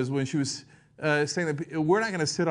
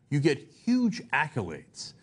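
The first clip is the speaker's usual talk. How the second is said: ordinary talk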